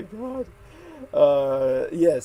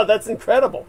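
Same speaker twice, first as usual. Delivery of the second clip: laughing; 9 semitones higher, 13 dB louder than usual. Real, not acted. Laughs in amazement.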